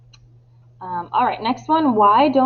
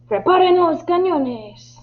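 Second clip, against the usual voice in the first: high, shouted command with a touch of fury; furious shout; 9 semitones higher, 3 dB louder than usual. Acted. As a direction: call out the order as an angry captain. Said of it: "Antonio está furioso y grita". (orden gritada con enfado)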